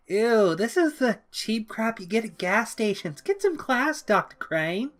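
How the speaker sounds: put-on high, sneering girlish disgust; high mocking Harley. A narrator's reading. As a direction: turn up your nose like Harley.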